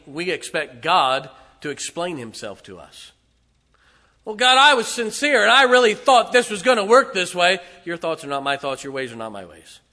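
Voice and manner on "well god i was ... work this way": loud, high earnest protest; mock sincere whining to God; aggrieved believer. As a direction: whine that you meant well. Preacher voices a sincere complainer.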